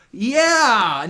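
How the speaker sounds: loud, high burst of cheer; excited yell. Real, not acted. Vlogger cheering about a football all-star, loud and high. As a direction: shout it out in delight. Cheering a player's new status.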